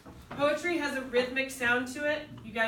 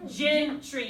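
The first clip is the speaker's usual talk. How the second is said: Gentry with the first syllable hit; first syllable of Gentry stressed. Shown as a demonstration. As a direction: lean on GEN in Gentry. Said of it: "so where's the stress?".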